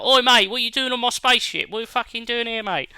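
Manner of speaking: hammed-up yob telling someone off his spaceship; gruff chav. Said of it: "Just gruff chavs".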